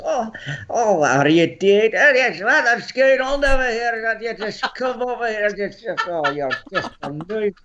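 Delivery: put-on high-pitched Scottish old-timer; older Scottish character. Shown as a demonstration. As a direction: play an elderly Scot in a put-on high voice. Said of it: "I have the older characters".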